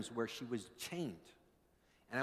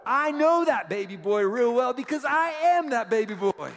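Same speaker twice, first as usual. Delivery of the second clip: high, loud impassioned sermon shout; rousing, high-pitched proclamation to the congregation; full-force preaching; 13 semitones higher, 14 dB louder than usual. Real, not acted. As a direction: preach it at the top of your voice.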